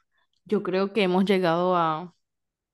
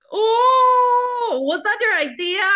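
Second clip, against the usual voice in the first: high amazed exclamation; surprised; 12 semitones higher, 7 dB louder, slower than usual. Real, not acted. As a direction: gasp of surprise. Surprised at the closed-eyes look.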